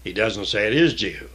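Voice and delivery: usual speaking voice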